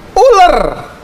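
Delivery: high, put-on blurt; Hawa blaming. Eve blaming the snake before God, high-voiced. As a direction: pass the blame in a high voice.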